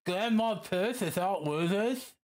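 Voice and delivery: tongue held between fingers; holding own tongue. Shown as a demonstration. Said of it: "Say that while holding your tongue".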